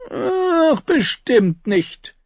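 high, drawn-out denial; dismissive contradiction. A narrator's reading. Tyrion contradicting his sister about a truce, dismissive. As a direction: wave it away in a slow, high voice. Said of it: "widersprach Tyrion".

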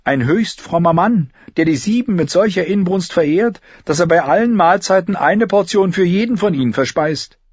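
high-pitched jester's voice; Mondbub. A narrator's reading. Fool joking about the pious, greedy High Septon, high jester voice. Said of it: "Was hatte Mondbub kürzlich über ihn gesagt?".

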